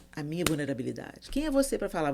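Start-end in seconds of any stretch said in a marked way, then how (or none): 1.3-2.1 higher-pitched indignant comeback, retold; offended retort. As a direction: imitate a friend taking offense. Retelling the offended friend's retort.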